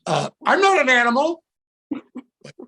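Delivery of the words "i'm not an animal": high, loud protest; high-pitched shouted retort; kid yelling back. Retold or imitated.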